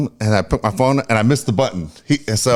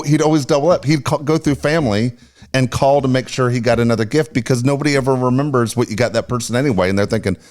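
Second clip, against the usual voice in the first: ordinary talk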